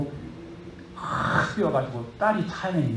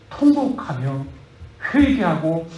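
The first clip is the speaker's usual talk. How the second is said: ordinary talk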